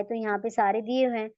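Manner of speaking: ordinary talk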